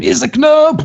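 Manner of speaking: high, loud cry of panic; scream. Acted. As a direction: scream it out as a doomed guard. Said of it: "they scream".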